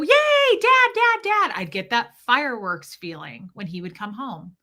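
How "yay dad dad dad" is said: put-on much higher, louder, thrilled little-kid squeal; excited child greeting. Little girl running to dad coming home, thrilled squeal. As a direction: squeal for Dad like a thrilled eight-year-old.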